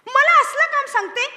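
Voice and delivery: high, loud affronted retort; indignant Arjuna. Acted. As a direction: bristle at the lowly task. Arjuna offended by fishing task. (Arjun अपमानित होऊन चिडून विचारतो)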